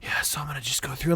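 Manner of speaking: soft, low ASMR streamer voice; mock sultry. Teller imitating the ASMR streamer, trying to be sultry. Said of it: "trying to be all sultry".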